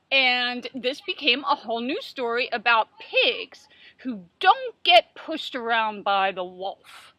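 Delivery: ordinary talk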